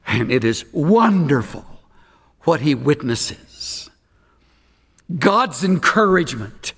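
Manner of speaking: ordinary talk, nothing marked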